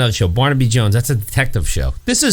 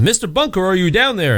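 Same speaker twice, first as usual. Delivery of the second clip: raised-pitch call into a basement; mildly mimicked call to someone below; 7 semitones higher than usual. Rescuer banging at basement doors, calling down. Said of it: "somebody's banging at and saying".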